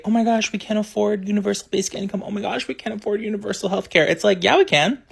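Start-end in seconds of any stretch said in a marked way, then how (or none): 0.0-4.1 quick, higher-pitched mimicking of naysayers; mock hand-wringing, rattled off fast; mock objection. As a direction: parrot their excuse in a rushed, higher voice. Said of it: "how can you with a straight face say".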